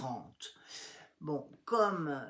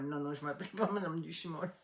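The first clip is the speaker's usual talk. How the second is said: ordinary delivery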